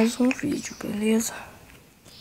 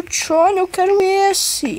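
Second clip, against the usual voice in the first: higher, eager begging for the pick; excited pleading; 7 semitones higher than usual. Real, not acted. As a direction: beg for it, pitch up. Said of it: "Comigo Johnny".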